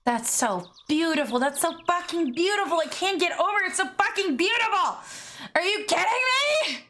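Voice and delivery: high-pitched, gushing awe; overwhelmed gushing. Real, not acted. Overwhelmed by beauty of the craft.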